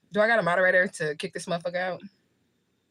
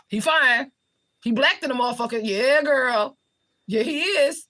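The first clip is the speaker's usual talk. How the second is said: gushing admiration; 7 semitones higher, 5 dB louder, slower than usual. Real, not acted. Woman telling sisters how fine he is, gushing. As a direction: hype him up, pitch climbing.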